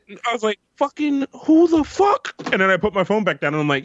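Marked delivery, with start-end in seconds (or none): 0.8-2.3 startled outrage. Retold or imitated. Startled by phone call.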